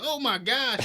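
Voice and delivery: loud, high-pitched mock horror; shocked onlookers. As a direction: imitate aghast bystanders crying out. Retells onlookers' shock at vomiting.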